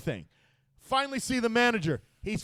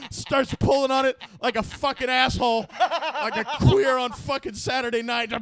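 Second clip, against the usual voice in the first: ordinary delivery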